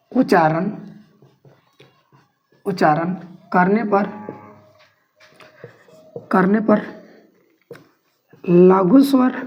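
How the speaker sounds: teacher dictating notes, pausing between chunks. Acted. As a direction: dictate each phrase for students to write.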